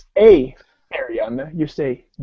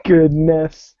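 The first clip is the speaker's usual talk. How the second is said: usual speaking voice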